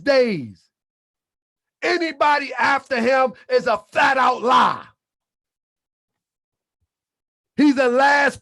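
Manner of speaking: loud, insistent preacher's cadence; emphatic sermon delivery; preaching. Acted. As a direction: drive the doctrine home. Preaching doctrine to the family.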